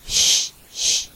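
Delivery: two soft, voiceless puffs of breath; blowing softly. A narrator's reading. Man teasing his lover's ear by phone, blowing softly. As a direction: blow playfully into the receiver. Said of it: "吹口气".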